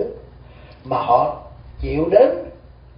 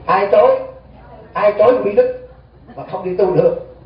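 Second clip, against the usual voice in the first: higher and louder, pressing the question; emphatic challenge; 5 semitones higher, 5 dB louder than usual. Real, not acted. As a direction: demand who binds them. Rhetorical challenge to the congregation.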